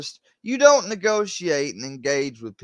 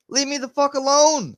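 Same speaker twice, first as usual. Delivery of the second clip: exasperated demand; 13 semitones higher, 3 dB louder than usual. Real, not acted. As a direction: demand it at the end of your tether, voice way up. Exasperated demand, before the accented repeat.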